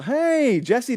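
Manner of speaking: ordinary talk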